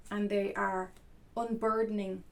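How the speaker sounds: usual speaking voice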